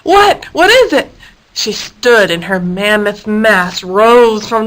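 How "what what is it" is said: high, louder startled cry; startled awake. A narrator's reading. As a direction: jolt awake and demand. Hippo woken abruptly.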